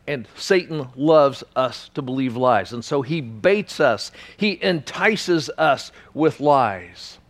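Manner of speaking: normal talking voice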